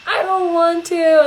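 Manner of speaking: mock child whining. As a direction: whine it like a sulking child. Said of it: "And the whining and the crying".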